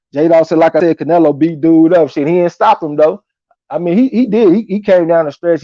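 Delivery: ordinary talk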